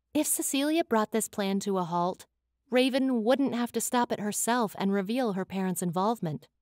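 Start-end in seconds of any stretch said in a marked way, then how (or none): none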